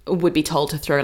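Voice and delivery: ordinary talk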